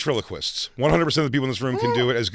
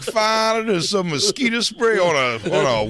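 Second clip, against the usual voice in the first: put-on Creole accent; 7 semitones higher, slower than usual. Creole sister bit.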